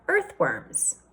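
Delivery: ordinary delivery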